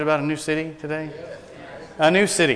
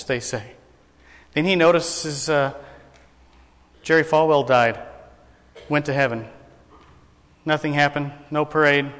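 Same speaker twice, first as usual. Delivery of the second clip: usual speaking voice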